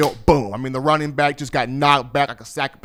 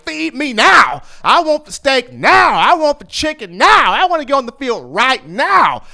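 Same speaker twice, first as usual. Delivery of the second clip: higher, louder, greedy demanding; hungry demand; 7 semitones higher, 3 dB louder than usual. Retold or imitated. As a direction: shout for it like a starving man. Hungry player demanding to play.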